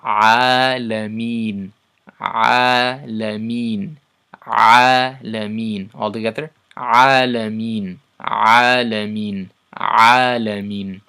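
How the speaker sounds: alameen drawn out very slowly and loudly; slow, loud Quranic drill; slow loud recitation demo. Shown as a demonstration. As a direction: chant alameen for students to copy. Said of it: "this part's gonna sound like this".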